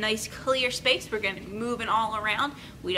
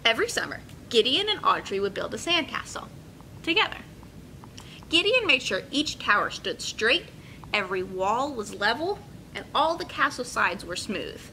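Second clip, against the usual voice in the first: put-on bright, paced read-aloud for kids; story narration. A narrator's reading. Teacher reading a picture book to her class, lively and clear. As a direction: tell the story to a room of children.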